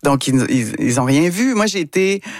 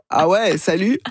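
a lightly put-on Québécois accent, higher; changed Québec accent; 5 semitones higher than usual. Introducing herself to French players, in a light put-on Québécois accent. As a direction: play up the Québécois accent a bit, pitched up. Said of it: "j'avais changé un peu mon accent".

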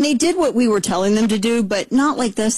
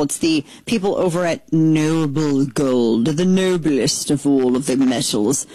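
lower, steady sponsor pitch; ad read; 4 semitones lower than usual. Acted. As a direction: sell it smoothly like a host ad spot.

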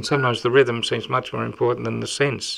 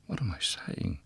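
breathy unvoiced whisper, much softer; 6 semitones lower, 10 dB quieter than usual. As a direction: hush it like a secret before the poem begins. Poem opens in a whisper.

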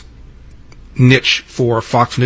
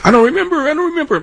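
high squeaky mocking voice, much higher; mocking Trump impression; faster than usual. Radio host retelling Trump's town hall, mocking high voice. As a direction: mimic Trump mocking a flustered reporter. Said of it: "mocking the journalist and waving his arms around".

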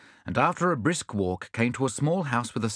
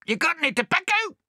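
very high, squawking shout; bad-tempered parrot; 3 dB louder than usual. Cranky swagman parrot shouting at Bill. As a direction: snap back in a squawk.

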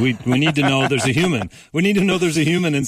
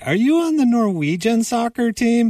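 much higher, a bit louder, mock-fan voice; imitating clueless fans; 14 semitones higher, 3 dB louder than usual. Fans asking Haaland if he plays for Norway, in a high mock-fan voice. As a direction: squeak it out like an eager clueless fan.

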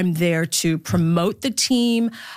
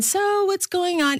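mock chatty mom; 12 semitones higher than usual. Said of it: "it's never been".

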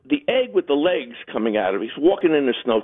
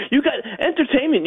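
laughing; 8 semitones higher than usual. Real, not acted. Laughing while telling.

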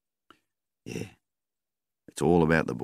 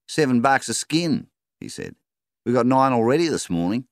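higher and louder, pleased; shooter's voice; 9 semitones higher, 4 dB louder than usual. A narrator's reading. Roo shooter bragging to the farmer, higher cheerful voice. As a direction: brag about the count. Shooter brags about skins.

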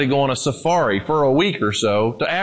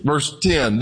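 normal talking voice

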